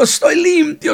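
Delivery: a high, loud overjoyed cry; emotional shout. Acted. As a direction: shout it out to the whole street. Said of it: "se oían los gritos de aquel hombre, emocionado". (grito alto y emocionado)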